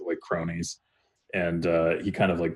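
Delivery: ordinary delivery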